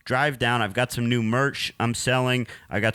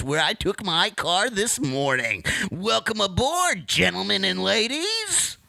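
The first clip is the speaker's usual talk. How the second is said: radio voice; 10 semitones higher than usual. Acted. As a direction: hype it like a DJ intro. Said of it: "my radio voice".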